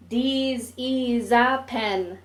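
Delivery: high, slow mock Japanese-English accent, drawn out. Narrator mocking his friend in a slow, high mock Japanese English accent. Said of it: "mimicking the first English learned".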